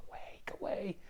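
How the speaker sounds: hushed whispered plea to leave; urgent whisper. Retold or imitated. Preacher whispering to the father to stay away. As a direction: breathe it out, don't let the son hear.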